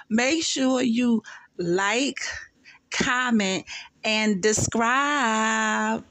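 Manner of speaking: slow, drawn-out sign-off; lingering sign-off to viewers; vlog outro. Real, not acted. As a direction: stretch each word like a YouTuber outro. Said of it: "outro sign-off".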